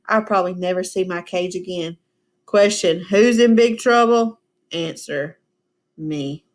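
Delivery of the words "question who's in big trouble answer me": put-on teacher reading a children's book aloud; story reading. A narrator's reading. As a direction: read it to the class like a storybook. Reading hamster's diary aloud.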